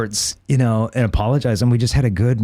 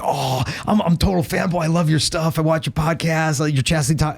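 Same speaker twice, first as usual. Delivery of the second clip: higher-pitched mock gushing; excited fanboy; 5 semitones higher than usual. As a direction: imitate a fan gushing to his idol. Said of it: "He was all excited about chastity".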